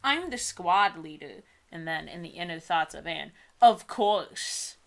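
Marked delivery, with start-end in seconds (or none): none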